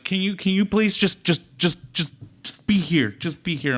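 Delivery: high, strained retold plea; pleading partner. Wife in labor pleads with husband, retold with strain. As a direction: beg him to just be present. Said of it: "and you'd be like".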